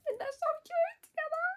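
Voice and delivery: very high, loud fangirl squeal; gushing squeal. Real, not acted. Reader adoring Ravi coming to the rescue, gushing squeal. Gushing over favourite couple.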